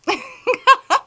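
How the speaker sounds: loud burst of high laughter. Real, not acted. To her viewers, a loud high burst of laughter. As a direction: she cracks up at her own joke, loud high laugh.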